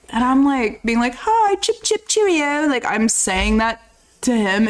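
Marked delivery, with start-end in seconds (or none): 1.1-2.7 mock British greeting. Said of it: "He's English".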